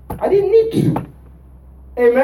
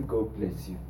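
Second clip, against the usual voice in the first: very soft, low God bless you; 13 semitones lower, 17 dB quieter than usual. Pretend. Preacher showing the congregation a soft answer, hushed and gentle. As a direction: answer the angry one gently. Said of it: "and say".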